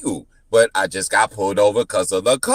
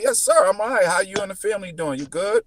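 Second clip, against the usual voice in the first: high, quick cheerful greeting; chipper friendly reply; 9 semitones higher, faster than usual. Retold or imitated. Driver greeting cops who stop him, cheerful. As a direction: sound breezy and upbeat.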